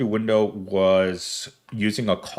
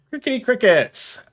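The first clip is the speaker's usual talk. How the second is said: laughing; 12 semitones higher than usual. Real, not acted. Laughing at silence.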